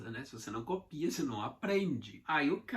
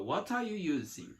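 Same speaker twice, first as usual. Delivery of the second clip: smooth, words run together as one; linked together; 4 semitones higher than usual. Shown as a demonstration. Teacher showing the natural flow, words linked. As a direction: glide it out in one breath. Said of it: "Tudo junto, ó".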